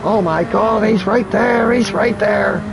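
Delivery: tense, rising excitement; panicking gamer. Real, not acted. Chasing a rival car live.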